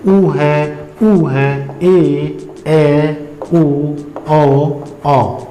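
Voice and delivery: each vowel said alone and held level; sustained, separate vowel demonstration; reciting vowel sounds. Shown as a demonstration. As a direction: list the Hindi vowels one by one, evenly. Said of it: "vowel sound का मतलब".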